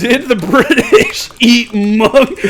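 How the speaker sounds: loud, high, laughing disbelief; drawn out and cracking up. Real, not acted. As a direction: read it out through loud laughter. Laughing while reading own search.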